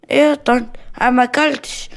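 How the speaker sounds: put-on high-pitched kid denying it; child protesting innocence. Child denies eating.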